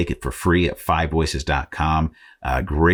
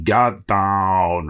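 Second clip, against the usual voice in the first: higher, louder mock Austrian action-hero bark; Arnold Schwarzenegger yell; 4 semitones higher than usual. Shown as a demonstration. Podcaster shows how he yells his Arnold live, Austrian accent. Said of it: "I yell it really loud".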